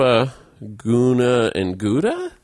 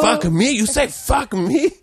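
very high, slow, mock outrage; high, drawn-out mock challenge; angry coworker; 13 semitones higher, slower than usual. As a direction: ham up a man squaring up with a knife.

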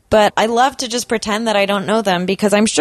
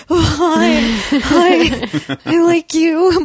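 starstruck gushing; 7 semitones higher, 3 dB louder, much slower than usual. Pretend. Imagined meeting with a crush.

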